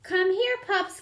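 calling out. A narrator's reading. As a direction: call the puppies in. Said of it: "called Purdy".

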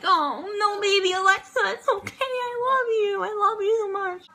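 high-pitched, tearful-sounding gushing comfort; cooing consolation. Acted. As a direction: coo it high, as if soothing a baby. Cooing comfort to upset dancer.

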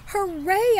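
high, loud hooray; joyful shout. Acted. Girl cheering at her mother's news, loud and high. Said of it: "I shouted".

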